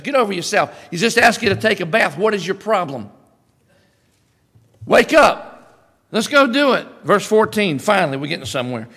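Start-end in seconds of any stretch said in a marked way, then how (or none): 0.0-6.9 louder, pushy wake-up call; servant urging. Retold or imitated. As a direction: shake him out of his pride. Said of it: "That's what his servant says".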